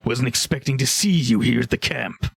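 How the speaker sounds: ogre character voice, casually curious; ogre trader. Acted. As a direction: play an ogre questioning unexpected visitors. Game master plays ogre trader.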